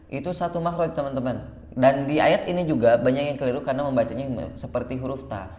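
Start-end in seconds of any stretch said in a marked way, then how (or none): none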